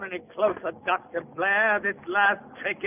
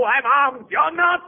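high, raised warning; agitated, higher-pitched threat; panicked threat; 7 semitones higher, 4 dB louder than usual. Acted. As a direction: warn off an attacker as panic climbs. Armed man threatens advancing slave.

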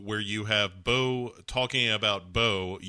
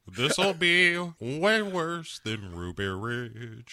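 very slow, ominous country drawl; hammed-up old-timer making threats; Uncle Jesse; slower than usual. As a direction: stretch each word into a mock warning. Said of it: "And Uncle Jesse says".

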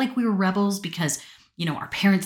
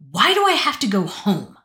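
put-on higher, louder snotty teen whine; 5 semitones higher, 7 dB louder than usual. Sulky teen daughter to her dad in the car, snotty whine. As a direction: sulk it at him in the car. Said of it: "Like, all snotty".

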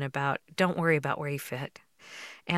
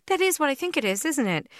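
excited; 11 semitones higher than usual. Retold or imitated. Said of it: "I was just like".

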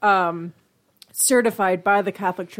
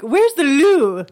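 "loo" stretched long, higher and louder; drawn-out LOO; 5 semitones higher, 7 dB louder, much slower than usual. Shown as a demonstration. Demonstrating how to ask for toilet, drawn-out hooted "loo". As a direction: hoot the word "loo" out slowly. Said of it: "You have to say it like that".

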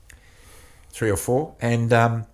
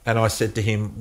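ordinary talk, nothing marked

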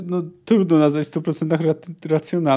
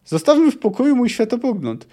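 a touch raised, stern rebuke; mildly stern scholar; 8 semitones higher than usual. Acted. Wysogota tells Ciri to leave his worldview alone, sternly. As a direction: brush her off, higher and firmer.

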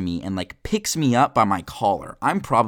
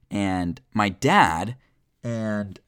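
ordinary delivery

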